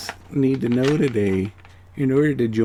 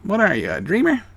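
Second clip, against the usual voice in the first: higher-pitched mock scolding of a dreamer; mimicked dismissive adults; 6 semitones higher than usual. Adults telling a kid to get a job, mock scolding. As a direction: lightly imitate adults dismissing a child's dreams. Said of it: "The adults in your life say".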